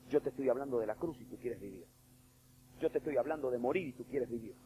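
ordinary delivery

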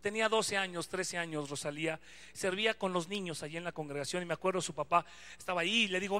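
ordinary delivery

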